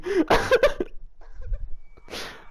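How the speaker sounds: breathy squealing laugh; high cracking laugh. Real, not acted. Laughing at odd sentence.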